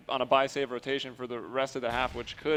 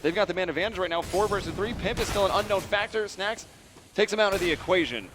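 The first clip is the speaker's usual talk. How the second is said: esports caster; 6 semitones higher, 3 dB louder than usual. Real, not acted. Caster calls a round building.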